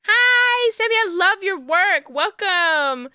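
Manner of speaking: high, loud happy greetings; excited welcome. Real, not acted. Streamer welcoming Sammy's raiders, high and loud. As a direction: keep the welcome bubbling over.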